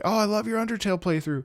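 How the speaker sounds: high-pitched compliment; imitated fan. Fan recognizes the streamer, high-pitched compliment.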